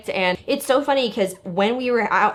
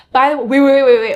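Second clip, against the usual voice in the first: louder, higher burst of wait, wait; sudden interruption; 4 semitones higher, 9 dB louder than usual. Real, not acted. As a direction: stop yourself short, startled. Suddenly interrupts her own thought.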